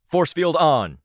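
loud, high battlefield order; shouted command. A narrator's reading. Dad turning daughter toward danger, shouting an order.

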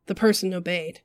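usual speaking voice

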